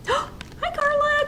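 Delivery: high, gasping delighted hello; excited greeting. Real, not acted. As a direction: greet a viewer with a high-pitched squeal of hello. Greets a viewer joining live.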